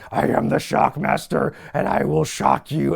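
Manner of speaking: a hint of a dubbed villain announcing himself; mock-grand villain intro, barely put on; lightly voiced Shockmaster. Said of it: "the dubbed voice starting to talk".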